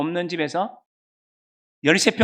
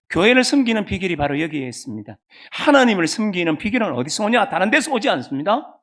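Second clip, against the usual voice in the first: usual speaking voice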